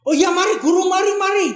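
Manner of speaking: high, bright eager yes; eager reply. Acted. Disciples eagerly answer teacher. (murid menjawab guru dengan semangat, suara tinggi)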